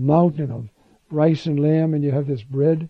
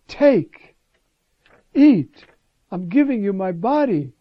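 much higher and louder, pouring out an earnest offer; earnest generosity; 5 semitones higher, 7 dB louder, slower than usual. Acted. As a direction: give it all, holding nothing back. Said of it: "Try to think of the earnestness, the generosity, the total, nothing held back".

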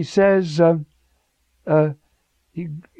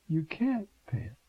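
usual speaking voice

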